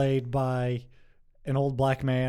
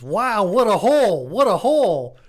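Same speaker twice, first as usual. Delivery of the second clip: astonished exclamation; 7 semitones higher, 9 dB louder than usual. Acted. Gynecologist reacts during exam in a joke, loud mock astonishment. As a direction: boom it out in mock wonder.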